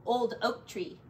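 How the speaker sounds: ordinary delivery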